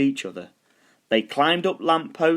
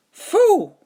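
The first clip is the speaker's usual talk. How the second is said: very high, loud dog puff; the dog skeleton blowing out; dog skeleton sound; 8 dB louder than usual. A narrator's reading. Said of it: "said the dog skeleton".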